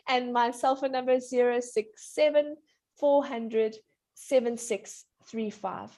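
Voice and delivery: phone number in slow, separated groups. Real, not acted. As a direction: dictate the digits slowly. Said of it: "slow down".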